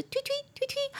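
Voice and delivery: tiny high-pitched chirps; bird tweeting. Shown as a demonstration. Demonstrating the onomatopoeia for a bird, high soft chirps. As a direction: chirp softly, far above your own voice. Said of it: "那个状声词啦".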